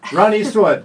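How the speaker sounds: loud, high announcer shout; big celebratory shout; birthday shout-out. Real, not acted. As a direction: call his name out like a party host.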